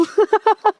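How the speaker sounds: sudden high, loud laugh. Real, not acted. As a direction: burst out laughing. Laughing at own joke.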